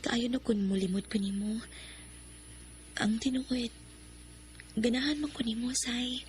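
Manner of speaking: breathy confessing whisper. Acted. Shai admits to Sai she likes him, whispering.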